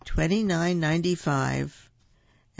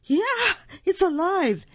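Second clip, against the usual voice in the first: very high, loud shriek of terror; panicked scream; 5 dB louder than usual. A narrator's reading. Man finding the doll is alive, panicked scream. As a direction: scream it way up high in comic horror. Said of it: "The man screamed".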